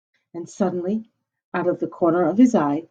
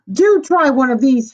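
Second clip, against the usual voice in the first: calling down; 6 semitones higher, 7 dB louder than usual. A narrator's reading. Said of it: "he called down".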